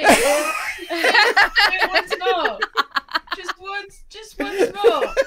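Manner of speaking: slow, high laughing beg; laughing plea. Pretend. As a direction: drag it out begging. Pleading, laughing, drawn out.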